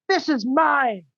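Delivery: put-on high, whiny little-kid voice; a petty child claiming a toy; like a toddler. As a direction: grab it back like a bratty toddler. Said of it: "Like some toddler".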